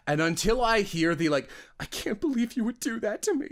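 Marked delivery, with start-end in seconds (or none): 1.8-3.5 mock sad. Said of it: "like, sad".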